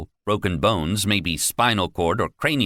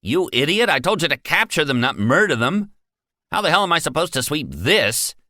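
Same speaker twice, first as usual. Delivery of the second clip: higher, louder furious tirade; angry yelling; 10 semitones higher, 4 dB louder than usual. Acted. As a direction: chew out the guard. Manager berates the guard.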